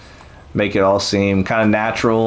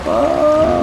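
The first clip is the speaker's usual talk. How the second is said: surprised. Real, not acted. Sudden drop on the ride.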